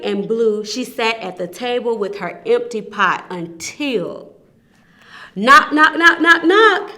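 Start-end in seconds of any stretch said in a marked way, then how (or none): 5.4-6.9 louder, higher knock calls. Acted. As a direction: rap it out loud. Loud knocking in story.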